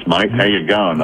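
a broad, jokey Australian accent; hammed-up Australian accent. Shown as a demonstration. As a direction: lay the Australian accent on thick, for a laugh. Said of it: "If I had an Australian accent".

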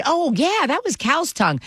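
imitated breezy reveal, pitched high; offhand reveal. Someone revealing the cow's tongue meal, imitated as high-pitched and offhand. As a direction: quote them letting it slip, voice lifted. Retold casual reveal of the meat.

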